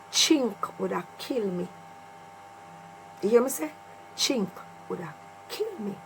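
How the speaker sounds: usual speaking voice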